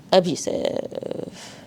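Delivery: "Abusive" whispered slowly and softly, falling at the end; whispered reveal. Shown as a demonstration. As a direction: breathe "Abusive" as a slow hushed answer. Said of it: "It's actually abusive".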